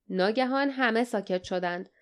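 usual speaking voice

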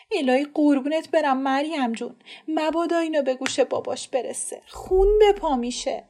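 a scared woman begging to keep a secret; frightened aunt. Acted. (زن ترسیده و ملتمس)

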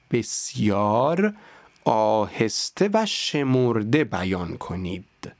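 slow and deliberate, word by word. Shown as a demonstration. Teacher demonstrating the slow practice pace. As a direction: stretch each word out evenly. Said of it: "با همین سرعت".

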